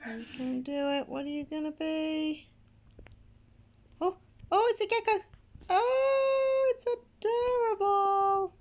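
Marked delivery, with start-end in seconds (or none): 4.0-8.5 high, loud delighted squeal; high-pitched squeal of real delight; excited squeal. Real, not acted. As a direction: gush over the cute pet. Gecko hatches, delight.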